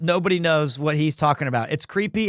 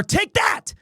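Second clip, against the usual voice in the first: high, rising courtroom shout; Phoenix Wright shout; 13 semitones higher than usual. Shown as a demonstration. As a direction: fling it like a winning attorney.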